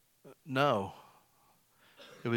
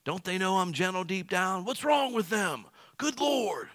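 exasperated; 7 semitones higher than usual. Retold or imitated. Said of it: "I'm like".

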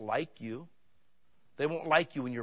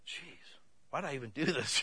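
dejected mutter; 7 dB quieter than usual. Retold or imitated. Deflated after failing the judges.

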